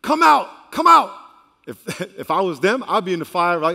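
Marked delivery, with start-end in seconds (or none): 0.0-1.1 loud, high-pitched summons; king calling out. Acted. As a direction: shout for them to come out of the furnace. Acts the king calling into the fire.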